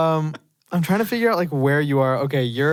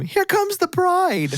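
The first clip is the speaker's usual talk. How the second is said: high, drawn-out mock scream; retold scream; slower than usual. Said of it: "hear one person scream".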